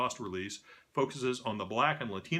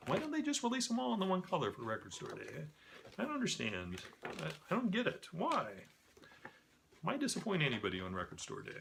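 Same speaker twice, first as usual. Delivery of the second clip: high, softer complaining voice; plaintive bewilderment; 6 semitones higher, 6 dB quieter than usual. Real, not acted. Host baffled by Record Store Day colors, plaintive. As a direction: protest in a small, high-pitched puzzled voice. Mock-upset about random colored copies.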